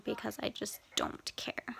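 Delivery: breathy whispered shrug; whispered aside. Real, not acted. Vlogger whispering she doesn't care about bruises. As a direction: whisper it like you don't care.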